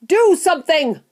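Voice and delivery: high, loud order; frantic high-pitched yell; screamed demand. A narrator's reading. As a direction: scream at your husband to act. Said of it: "Missus Gloop screamed at Mister Gloop".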